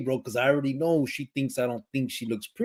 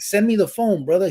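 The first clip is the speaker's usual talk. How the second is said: retold call, higher and a bit louder; 7 semitones higher, 4 dB louder than usual. Inmate asking his buddy for the phone, calling out. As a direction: call across to a buddy, pitched up.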